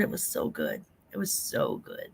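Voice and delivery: hushed, whispered rapture over the food; soft dreamy whisper; whispered bliss. Real, not acted. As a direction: breathe it out like a sweet memory. Savoring remembered food, breathy whisper.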